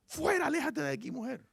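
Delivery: high-pitched mock shooing away; acted rejection. Hosts driving the uninvited woman away, high-pitched and dismissive. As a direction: send her off in a high, put-on voice.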